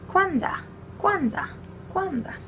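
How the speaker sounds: sing-song high "Kwanzaa" falling off; chanting "Kwanzaa" high and falling. Shown as a demonstration. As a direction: lilt "Kwanzaa" high then drop. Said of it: "repeated high".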